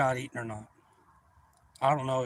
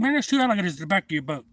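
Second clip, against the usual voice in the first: fast, raised-voice alarm; retold urgent warning; 5 semitones higher, 5 dB louder, faster than usual. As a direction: warn him quickly, higher and louder. Urgent warning about alligators.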